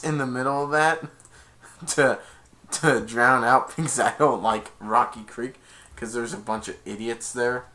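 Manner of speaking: usual speaking voice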